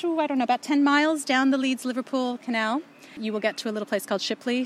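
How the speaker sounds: normal talking voice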